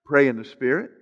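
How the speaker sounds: usual speaking voice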